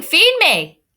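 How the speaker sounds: feed me like a starving truck; truck saying feed me. Retold or imitated. Teacher quoting the truck for the class, big hungry voice. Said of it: "So the truck is saying".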